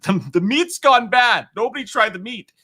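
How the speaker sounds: high, loud, slowed hammed-up alarm; mock news alarm. Streamer retelling a spoiled-meat news story, high mock alarm. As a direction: cry it out like a silly panicked bulletin.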